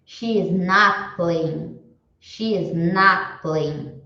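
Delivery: slow, deliberate English; careful teacher demo; slow model sentence. Shown as a demonstration. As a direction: spell it out slowly for the class. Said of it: "Como que vocês escreveriam".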